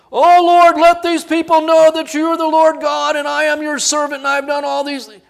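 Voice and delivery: high, loud, grand pleading to the Lord; loud showy prayer. Retold or imitated. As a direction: proclaim it loudly, showing off before the crowd. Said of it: "he would have gone".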